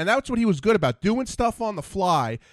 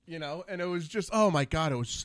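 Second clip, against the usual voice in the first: usual speaking voice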